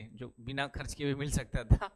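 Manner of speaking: ordinary delivery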